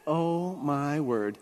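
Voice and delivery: very slow, drawn-out realisation; slow disbelief. Retold or imitated. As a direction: stretch each word in retold disbelief. Retold discovering the door opens.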